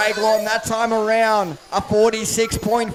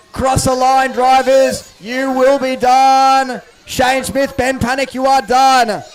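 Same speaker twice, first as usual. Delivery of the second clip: finish call; 3 semitones higher, 6 dB louder, slower than usual. Real, not acted. Calling the finish.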